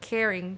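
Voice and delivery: "caring" said in English, mid Spanish sentence; English word "caring" dropped into Spanish; spanglish. Real, not acted. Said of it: "perdón por el Spanglish".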